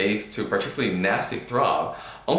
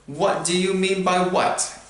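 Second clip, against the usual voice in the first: coldly; 10 semitones higher, slower than usual. A narrator's reading. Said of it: "said Harry coldly".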